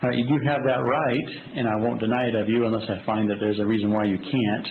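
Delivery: usual speaking voice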